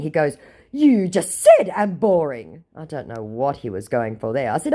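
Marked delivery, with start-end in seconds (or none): 0.7-2.6 mock scammer voice.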